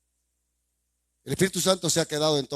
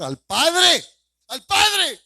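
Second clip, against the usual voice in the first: high emphatic shout; 14 semitones higher, 8 dB louder, much slower than usual. Real, not acted. Preacher shouting the question at the congregation, high and loud.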